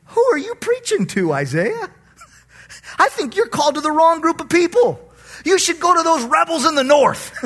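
high, loud, huffy protest; mock the self-righteous listeners; indignant religious folk. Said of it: "they were thinking".

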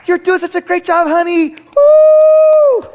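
very high, loud sideline cheer; cheering wife. Retold or imitated. Wife cheering her husband at the game, very high and loud. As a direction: cheer him on in a very high squeal. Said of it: "she's just like".

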